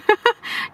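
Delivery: high-pitched acted chuckling; big laugh. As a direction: laugh heartily at someone's story. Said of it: "nó cười quá trời". (cười ngặt nghẽo)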